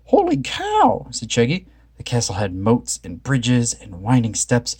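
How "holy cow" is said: high, slow, stunned; amazed. A narrator's reading. Shaggy gaping at the castle, high amazement. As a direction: let the jaw drop on it. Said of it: "jaws dropped".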